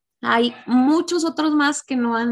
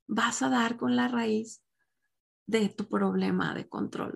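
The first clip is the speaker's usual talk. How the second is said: ordinary delivery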